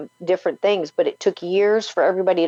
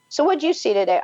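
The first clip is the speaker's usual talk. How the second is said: mock parent asking; 9 semitones higher, faster than usual. Mock dinner table chat.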